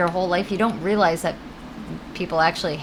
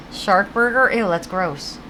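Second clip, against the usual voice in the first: usual speaking voice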